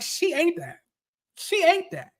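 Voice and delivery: high, softened voice of relived awe; awed approval. Retold or imitated. Reliving own reaction to Britt's comeback, awed approval. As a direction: say it small and high, impressed by the comeback.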